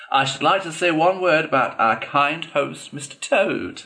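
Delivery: high-pitched after-dinner orator; high, pompous banquet speech; speechmaking weasel. A narrator's reading. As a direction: make a toast in a high weasel voice. Weasel's mocking banquet toast.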